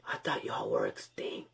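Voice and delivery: hushed, unvoiced private thought; inner thought. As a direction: whisper it to yourself, as if no one should hear. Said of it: "I'm thinking in my head".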